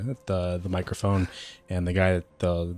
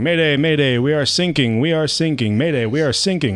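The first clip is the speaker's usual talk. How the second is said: loud, high, urgent distress call; panicked mayday; 6 semitones higher, 7 dB louder than usual. Retold or imitated. Retelling the coast guard ad, a loud mock mayday. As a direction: shout it like a sinking ship's radio. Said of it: "comes a voice going".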